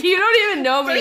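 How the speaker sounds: high, quick sassy retort; snappy quoted comeback. Retold or imitated. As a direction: toss it off with attitude. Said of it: "I was like".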